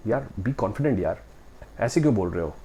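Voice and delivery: ordinary talk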